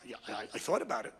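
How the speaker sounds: low, hesitant mumbled excuse; feeble excuse. Acted. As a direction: stammer it out low, half-hearted.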